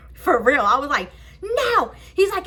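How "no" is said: high, drawn-out no; retold high-pitched defiance; defiant refusal. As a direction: call your refusal through the door. Refusing the officer through door.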